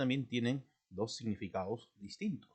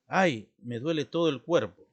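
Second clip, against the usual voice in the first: demonstrated cry of pain, louder; pained exclamation; 3 semitones higher, 7 dB louder than usual. Shown as a demonstration. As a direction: exclaim it as if the whole body aches. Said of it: "denota, eh, un sentimiento, digamos, de dolor". (exclamación de dolor)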